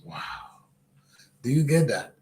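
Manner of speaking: ordinary talk, nothing marked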